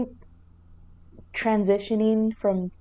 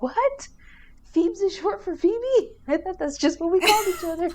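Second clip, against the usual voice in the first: much higher, fast mock-surprised Phoebe; 10 semitones higher than usual. Friend retells Phoebe learning her nickname, high mock surprise. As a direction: squeak out the confused discovery. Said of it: "And she's like".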